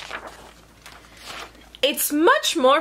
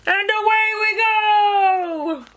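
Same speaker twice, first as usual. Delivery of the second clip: high, long drawn-out cheer; joyful send-off; 8 semitones higher, slower than usual. Acted. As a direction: sing it out slowly as you lift off. Takeoff refrain.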